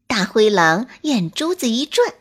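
plain reading voice

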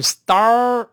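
star元音读成“二”，夸张拖长、调子偏高; 示范错误的star，一开始就卷舌成“二”; 错读成“二”. Shown as a demonstration. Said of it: "读成二也不对啊". (star不张嘴直接卷成“二”)

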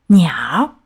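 low, secretive reveal; mysterious. A narrator's reading. Frog revealing its discovery to the fish, mysterious. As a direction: drop it like a secret, low-pitched. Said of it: "青蛙神秘兮兮地说".

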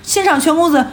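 high mock announcement with a laugh; club announcer. Club host hailing the rich patron, mock announcer.

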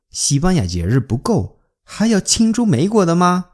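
ordinary talk, nothing marked

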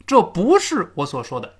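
normal talking voice

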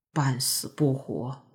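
slow, low, hushed murmur; low and somber. A narrator's reading. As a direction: drop the voice into dark memory. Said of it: "南低沉地说".

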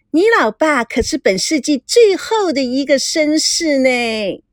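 high, sugary coquette voice; coquettish. Acted. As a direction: coo flirtatiously, stretching the final particle. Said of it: "吴梦玲娇声娇气地对孙娜说". (娇声娇气)

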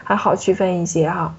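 usual speaking voice

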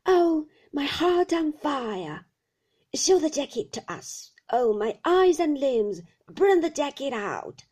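put-on fierce old shopkeeper wailing; old man's cry. A narrator's reading. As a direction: rant it in a fierce whining singsong. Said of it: "cried the old man".

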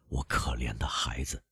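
very soft, low, whispery pity; sorrowful murmur. A narrator's reading. Villefort thinks of his only son, sorrowful murmur. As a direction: drop to a tender, hushed lament. Father pities his child.

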